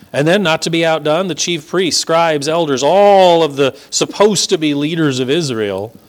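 ordinary delivery

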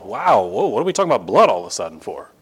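ordinary talk

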